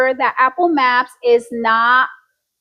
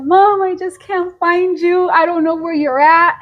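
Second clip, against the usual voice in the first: imitated tearful daughter; 3 semitones higher than usual. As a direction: imitate a lost, upset daughter, slightly higher. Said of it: "She calls me crying".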